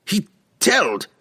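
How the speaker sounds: high, emphatic dwarf voice cut short; heated dwarf insistence breaking off; faltering dwarf. A narrator's reading. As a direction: begin hotly, then stop dead. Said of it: "The dwarf stopped".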